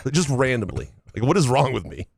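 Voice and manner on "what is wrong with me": normal talking voice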